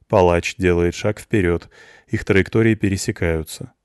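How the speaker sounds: plain reading voice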